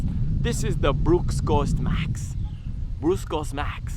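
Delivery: Brooks Ghost Max slowed right down, consonants melted away as pruss coss max; mushy, consonant-free name. Shown as a demonstration. Reviewer savoring the shoe name, mushy and slow. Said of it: "I feel like I have to say it like that".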